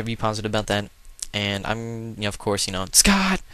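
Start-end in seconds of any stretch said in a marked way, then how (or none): 2.9-3.4 a sudden high, loud cry of the name; dramatic shout. Pretend. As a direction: shout the name out like a big reveal. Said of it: "I'm just gonna keep saying it like that".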